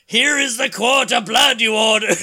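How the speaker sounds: loud, high, hammed-up ghoul announcement; spooky delivery man. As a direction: boom it out like a cheesy horror host. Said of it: "and it says".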